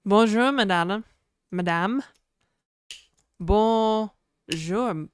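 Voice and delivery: playful fake French accent; mock French accent. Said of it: "my terrible French accent".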